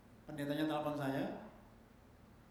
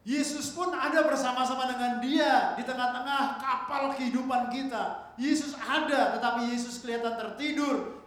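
full-throated preacher's proclamation; impassioned preaching; 8 semitones higher, 10 dB louder than usual. Real, not acted. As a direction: preach it at full force, raised voice, stretching the key words.

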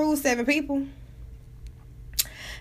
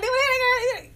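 Southern geechee talk; 11 semitones higher than usual. Retold or imitated. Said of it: "we talk, they call it geechee".

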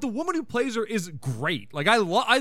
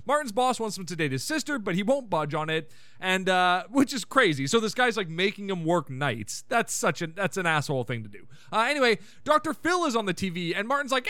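ordinary talk

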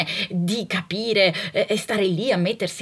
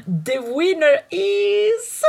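high, slow, drawn-out reveal; game-show host building suspense; mock announcer; 7 semitones higher, much slower than usual.

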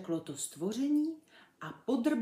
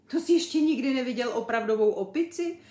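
quick, loud, high question; incredulous chimp, rattling out the question; amazed; 5 semitones higher, 9 dB louder, faster than usual. A narrator's reading. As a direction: fire it off in disbelief. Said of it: "divil se Zulu".

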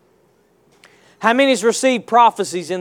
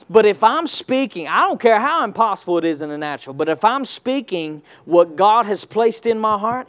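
normal talking voice

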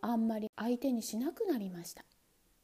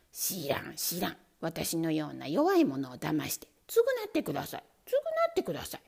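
whiny pleading demand; 6 semitones lower than usual. Acted. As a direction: nag for payback. Mouse demands compensation.

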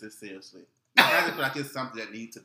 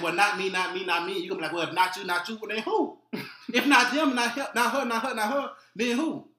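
mock denial; 7 semitones higher, 5 dB louder than usual. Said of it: "you gonna have people saying".

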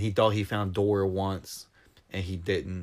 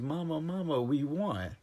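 put-on excited child; 7 semitones higher than usual. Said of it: "and he runs".

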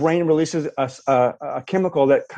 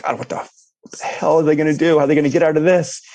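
mock suspense; 3 semitones higher, faster than usual. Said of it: "you're on the edge of your seat".

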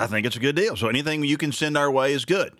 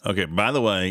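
ordinary delivery

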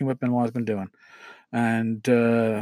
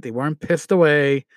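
normal talking voice

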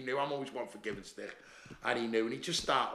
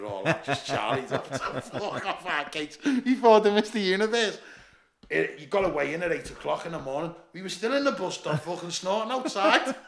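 laughing retelling; 4 semitones higher, 8 dB louder than usual. Real, not acted. Laughing retelling of drug night.

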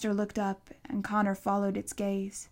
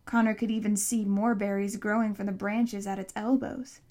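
ordinary delivery

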